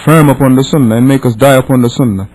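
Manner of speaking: usual speaking voice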